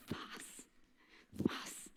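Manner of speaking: breathless hushed what; barely voiced, struck dumb by what she said; stunned whisper. Told she saved a life, a stunned hushed whisper.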